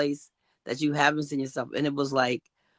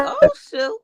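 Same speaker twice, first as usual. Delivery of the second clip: surprised exclaim; 6 dB louder than usual. Retold or imitated. Surprised it worked.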